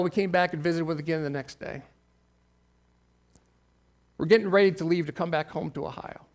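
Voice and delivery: usual speaking voice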